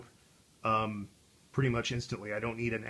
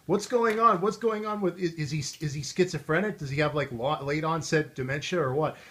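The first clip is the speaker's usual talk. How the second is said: high, loud puzzled questioning; mock worried viewers; 5 semitones higher, 4 dB louder than usual. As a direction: ask in a high voice what is wrong with him. Viewers posting worried comments.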